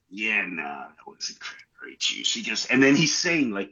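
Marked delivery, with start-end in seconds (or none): 0.1-2.3 hushed doctor voice; low grim whisper. Retold or imitated. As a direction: whisper it gravely. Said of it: "the doctor's like".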